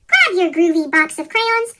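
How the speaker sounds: plain reading voice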